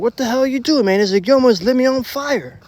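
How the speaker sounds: high, rattled complaint; shaken friend. Retold or imitated. Friend accusing narrator of nearly burning him, high and shaken.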